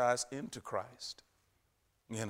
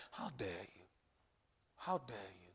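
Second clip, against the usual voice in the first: low, much softer taunt; devil's taunt; 4 semitones lower, 8 dB quieter than usual. Acted. Preacher voicing the devil to someone preaching, low and soft. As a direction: say it quietly, like a sly accusation. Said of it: "the devil is saying".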